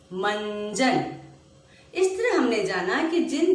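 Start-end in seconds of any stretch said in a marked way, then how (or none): 0.1-1.1 मंजन drawn out slowly, nasal न hummed; slow nasal demo. Shown as a demonstration. Teacher showing pupils the nasal sound, slowly. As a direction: let मंजन ring through the nose. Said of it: "बोलते समय नाक से आवाज निकलेगी।".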